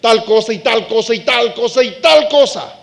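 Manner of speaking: fervent mock prayer; psalmist pleading. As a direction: call out to the Lord urgently. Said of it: "empieza David diciendo".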